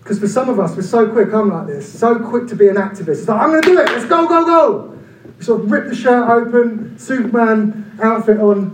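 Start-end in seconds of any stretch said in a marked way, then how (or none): 3.4-4.9 much higher and louder, hammed-up charge; hyped-up rush. Preacher spoofing activists who rush in, loud mock hype. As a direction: rev it up like a cheerleader.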